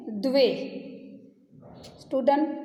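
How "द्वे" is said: द्वे said as d-we, the v joined to d; pronunciation demo. Shown as a demonstration. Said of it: "टू को संस्कृत में बोलते हैं". (द्वे का उच्चारण, द और व जुड़े)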